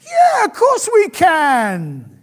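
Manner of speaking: loud, high, drawn-out eager cheer; overconfident enthusiasm. Retold or imitated. Disciples answering Jesus about the cup, wildly enthusiastic. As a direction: shout it with gung-ho confidence, stretching it slowly. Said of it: "their enthusiasm was such".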